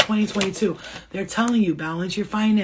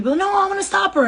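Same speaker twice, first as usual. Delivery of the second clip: much higher, fast, alarmed little voice; high, quick mock protest; squeaky panicked guide; 12 semitones higher, 5 dB louder, faster than usual.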